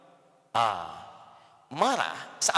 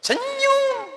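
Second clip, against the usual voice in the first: sweet smile voice; 3 dB louder than usual. Shown as a demonstration. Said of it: "Apa lawan cemberut?".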